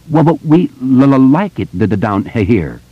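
stammering but defiant; stuttering. A narrator's reading. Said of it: "Bill said suddenly and defiantly".

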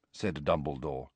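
plain reading voice

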